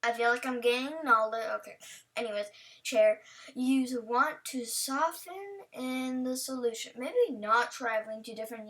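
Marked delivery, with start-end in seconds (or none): none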